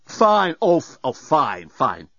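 starts high and drops with each repeat; mock agreement, stumbling and sinking; sarcastic. A narrator's reading. Dan scoffing at Nettie's suggestion, sarcastic. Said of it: "Dan was beside himself with sarcasm".

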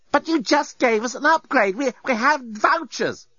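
high, desperate pleading; desperate protest. Acted. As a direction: plead with rising panic, high. Lucy pleading with deskbot.

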